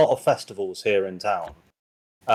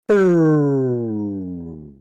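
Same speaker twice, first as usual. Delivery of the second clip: ghostly boo; 3 semitones higher, 5 dB louder than usual. Acted. Warforged's last cry.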